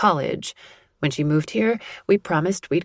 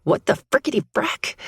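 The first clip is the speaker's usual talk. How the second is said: startled yelp; 10 semitones higher than usual. A narrator's reading. Startled heroine blurts.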